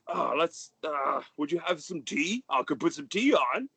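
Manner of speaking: high, merry fatherly gush; jolly father. Acted. The merry father welcoming his son home, high and gushing. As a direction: beam over the boy like a proud dad.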